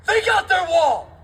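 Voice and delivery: slow high shout; rally shout. Real, not acted. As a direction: land it hard and high. Rally climax.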